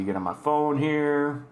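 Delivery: ordinary talk, nothing marked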